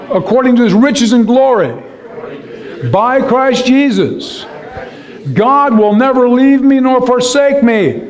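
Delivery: rising high, ringing confession of faith; leading the church in spoken declaration; declaring scripture. Shown as a demonstration. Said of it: "do this with me, speak this".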